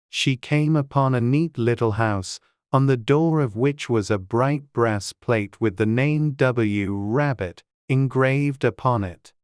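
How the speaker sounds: usual speaking voice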